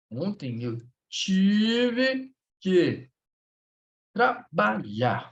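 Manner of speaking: very slow, stretched word by word; slow dictation. Shown as a demonstration. Teacher sets class a sentence to translate, slow dictation. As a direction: draw out "tive que", then space out "trabalhar".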